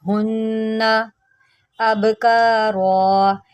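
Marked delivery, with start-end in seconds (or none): 0.0-1.1 हुन्ना with a held nasal nn; हुन्ना with ghunna. Shown as a demonstration. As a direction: hold the nn of हुन्ना in the nose. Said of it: "मैंने यहाँ पर गुन्ना किया".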